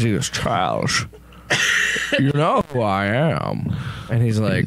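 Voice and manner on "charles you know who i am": booming god voice. Acted. God voice in the story.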